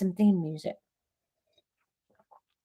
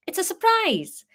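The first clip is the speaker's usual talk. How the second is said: high bright Welsh lilt; high cheerful Welsh voice; cheerful Welsh Harry; 12 semitones higher, 3 dB louder than usual. Acted. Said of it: "Harry said cheerfully".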